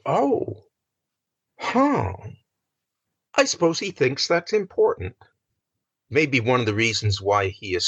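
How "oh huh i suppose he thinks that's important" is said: mock dismissive. Mock listener's dismissive reaction.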